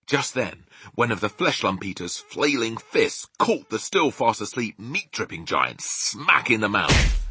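ordinary delivery, nothing marked

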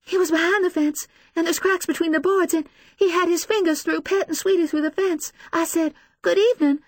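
high, frantic tearful account; breathless distress. A narrator's reading. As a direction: tell it in rising panic. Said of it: "She can hardly catch her breath".

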